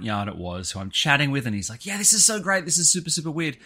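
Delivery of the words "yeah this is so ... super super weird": high, excited quoted voice; lifted, enthusiastic pitch; delighted retelling. Retold or imitated. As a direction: imitate someone thrilled by a weird coincidence. Quotes the delighted front-yard guy.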